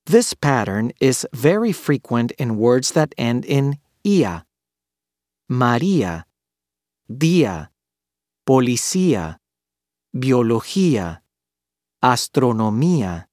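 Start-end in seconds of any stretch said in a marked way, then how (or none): 4.0-13.2 stressed Í, then separate A in "ia", "María", "día", "policía", "biología", "astronomía"; vowels kept apart. Shown as a demonstration. Teacher shows words ending in ia, stressed i split from a. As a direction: end on two syllables, Í-a: ma-RÍ-a, DÍ-a, poli-CÍ-a. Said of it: "two consecutive vowels do not form a diphthong".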